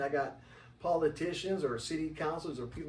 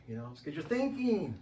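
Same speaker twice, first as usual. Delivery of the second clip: usual speaking voice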